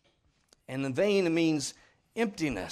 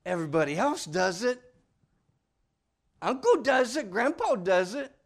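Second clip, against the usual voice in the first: put-on higher, louder whiny kid voice; a lightly voiced whining child; child's excuse; 5 semitones higher, 8 dB louder than usual. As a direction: plead that everyone does it. Preacher mimics child's excuse.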